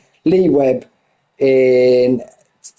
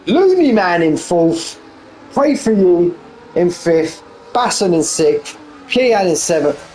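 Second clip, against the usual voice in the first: raised, running play-by-play call; live race commentary; 5 semitones higher than usual. Acted. Commentator calling the sim race finish to viewers. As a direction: call the final laps as they unfold.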